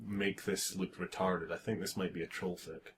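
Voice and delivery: ordinary talk, nothing marked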